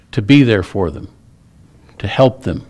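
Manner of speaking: usual speaking voice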